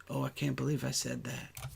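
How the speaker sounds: normal talking voice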